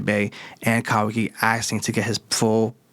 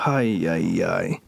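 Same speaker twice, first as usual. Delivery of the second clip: exasperated ay ay ay; 6 semitones lower, much slower than usual. Real, not acted. Exasperated at the shinobis again.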